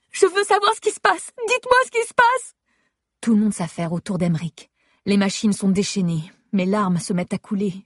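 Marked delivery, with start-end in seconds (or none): 0.1-2.5 frantic high shout. Acted. Wife screaming at the medical team for answers, frantic and high. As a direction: scream the demand, voice cracking high. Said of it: "Je me mets à crier".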